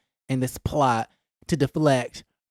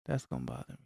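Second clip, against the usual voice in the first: pitched-down echo; 18 dB quieter, faster than usual. Shown as a demonstration. Repeat pitched far down.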